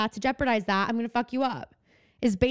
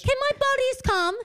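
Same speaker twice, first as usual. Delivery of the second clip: high mock British child voice; a prim British kid begging; mock British Annie; 13 semitones higher than usual. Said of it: "And suddenly she's British".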